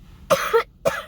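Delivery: loud hacking cough; coughing fit. Real, not acted. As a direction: cough hard and sharply. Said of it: "I'm coughing".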